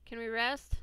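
ordinary delivery

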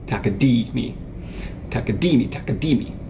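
"Takadimi" with the third syllable DI accented; stress on DI. Shown as a demonstration. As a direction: chant takaDImi, leaning on "di". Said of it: "we put it on the third".

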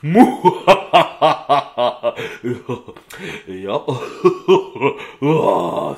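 booming mock-evil cackle; hammed-up monster laughter trailing into a groan. Acting out a scary monster costume.

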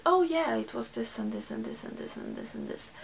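usual speaking voice

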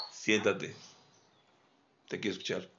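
low, soft and steady command; soft but firm. Shown as a demonstration. Trainer tells the dog to sit, soft but firm. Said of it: "con voz suave pero firme".

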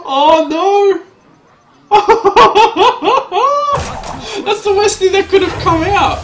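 shouted high through big laughter; loud laughing dismay. Real, not acted. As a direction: howl with laughing horror at the card. Horrible card comes out.

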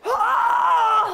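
piercing high scream; death scream. Retold or imitated. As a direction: scream at the top of your lungs. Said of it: "sounded like someone screamed at the top of their lungs".

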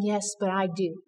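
a hushed, loving answer; gentle reassurance. Retold or imitated. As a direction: soothe her quietly as God would. God answers her tenderly.

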